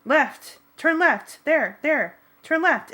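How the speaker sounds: loud high urgent commands; shouting directions. A narrator's reading. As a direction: yell the turn at the driver. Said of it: "taking time out from screaming".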